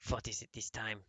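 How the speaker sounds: ordinary delivery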